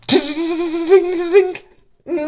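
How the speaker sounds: high sung ding ding; bell sounds. Acted. Guide voicing bells ringing at Noska's door. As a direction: chime it out like bells.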